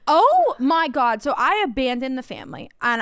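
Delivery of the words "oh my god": exasperated. Retold or imitated. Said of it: "And I was like".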